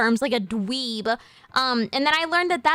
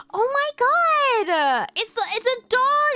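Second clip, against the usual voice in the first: much higher, very slow, squealing delight; gushing over a cute dog; 11 semitones higher than usual. Retold or imitated. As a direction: coo over the puppy like a fan. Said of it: "so I'd be like".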